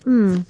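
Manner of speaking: drawn-out hum of delight over a chip; pleasure moan. Real, not acted. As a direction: moan with pleasure while tasting. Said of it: "quite a moan there".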